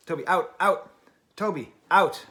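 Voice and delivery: firm shout telling Toby out; calling the dog. Real, not acted. Owner orders his dog Toby off, loud command.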